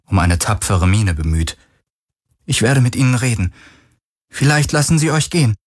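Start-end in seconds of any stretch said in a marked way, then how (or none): 2.5-5.5 put-on higher, tentative girlish offer; brave but hopeless. A narrator's reading. Girl offering to negotiate for the crew, little hope. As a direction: try to sound brave without hope. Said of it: "dass ihrer Stimme eine entscheidende Zutat fehlte".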